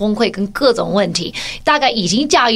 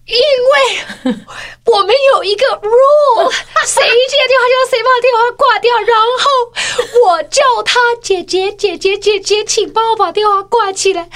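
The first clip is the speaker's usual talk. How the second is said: put-on high, crying child's voice, much higher; whiny sobbing kid pleading her case; tearful little girl; 11 semitones higher, 3 dB louder, slower than usual. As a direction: sob it out as the wronged little sister. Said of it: "崩泪这样子说".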